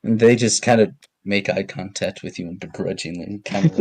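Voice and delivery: ordinary talk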